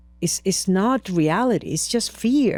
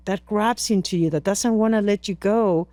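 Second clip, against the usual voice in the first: ordinary talk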